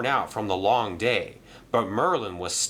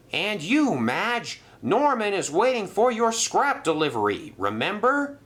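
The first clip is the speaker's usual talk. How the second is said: scolding manager; 11 semitones higher than usual. Acted. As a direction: tell him off about the fish. Percival scolds Madge.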